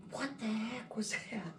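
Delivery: hushed, breathy, rattled whisper; a startled whisper after a ghostly touch; spooked whisper. Retold or imitated. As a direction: whisper it like you just got touched by a ghost. Spooked guest reacts to ghost.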